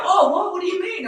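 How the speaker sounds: a high, loud burst of disbelief; imitating friends who can't believe it; shocked disbelief. Mates react to his story with loud, high disbelief.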